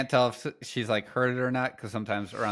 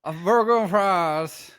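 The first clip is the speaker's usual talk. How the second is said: high, loud, slow Southern order; slow Southern drawl at a drive-through; Southern accent; 10 semitones higher, 9 dB louder, slower than usual. Retold or imitated. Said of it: "a southern accent".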